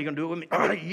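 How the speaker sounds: ordinary delivery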